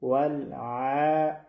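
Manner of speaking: ولع chanted slowly with a soft mid-throat ayn; slow, unblocked tajweed ولع; soft, clear ayn. Shown as a demonstration. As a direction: let the ayn in ولع flow long, no catch. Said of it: "نرم، بدون اینکه کدام بندشی".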